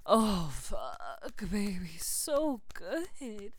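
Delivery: high loud cries of pleasure; climax moans. Acted. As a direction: let the moans break out loud. Climax moaning.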